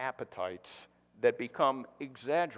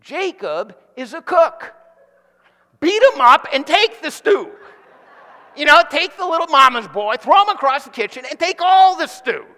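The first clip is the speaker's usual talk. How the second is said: much higher and louder, laughing rally cry; egging on; 13 semitones higher, 15 dB louder than usual. Acted. Pastor hyping Esau to throw Jacob across the kitchen, loud. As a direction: roar him into action, half joking.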